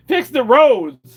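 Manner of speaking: shouted slow and high; mock rally shout. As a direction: yell it like a protest chant. Chanting protest slogan.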